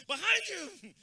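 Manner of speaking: a loud, high comic holler; high mock shouting. Mocking cholos in a piñata-style fight, high mock shouting.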